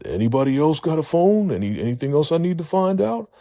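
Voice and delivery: high, loud demanding voice; demanding questions. Retold or imitated. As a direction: bark out the questions. Recapping a character interrogating captives.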